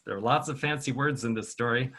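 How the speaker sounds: ordinary delivery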